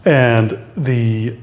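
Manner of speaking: normal talking voice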